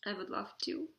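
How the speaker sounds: tearful, voice breaking; choked up and halting; crying. Real, not acted. Crying while addressing niece.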